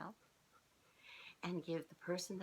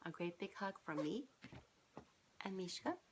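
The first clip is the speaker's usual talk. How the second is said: ordinary delivery, nothing marked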